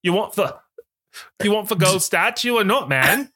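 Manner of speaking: high-pitched, put-on Cockney; Cockney-skewed impression. Nicole coaching Bradley about winning a statue, in wobbly Cockney. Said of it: "skewing a little bit Cockney".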